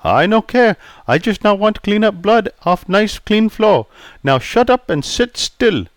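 put-on polite Chinese-accented barber greeting. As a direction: voice Pei the barber, courteous.

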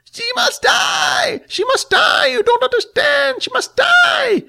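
very high, slow screeching creature voice; shrieking monster. Acted. Dying monster screams.

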